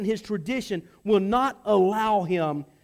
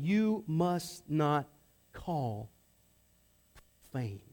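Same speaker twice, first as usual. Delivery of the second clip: slow, dropping much lower and softer; solemn divine voice; 5 semitones lower, 7 dB quieter, slower than usual. Acted. The voice of God commanding Peter, slow and grave. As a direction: pronounce it gravely, word by word.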